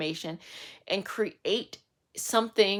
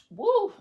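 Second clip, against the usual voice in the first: whoop; 13 semitones higher, 3 dB louder than usual. Real, not acted. Refreshed after channelling.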